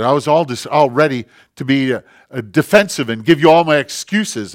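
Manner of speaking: acted exasperated husband, loud and high; angry outburst. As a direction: burst out at your wife, loudly. Retold husband's outburst at wife.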